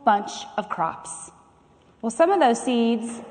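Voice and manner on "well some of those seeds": usual speaking voice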